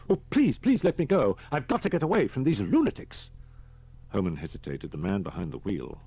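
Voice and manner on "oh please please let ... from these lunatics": high, loud, frantic plea; startled pleading. A narrator's reading. Startled man begs Holman to let him go, high frantic plea. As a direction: beg in a startled, high-pitched panic. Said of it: "the startled man said".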